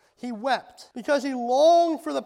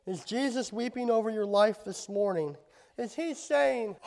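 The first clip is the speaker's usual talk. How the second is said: normal talking voice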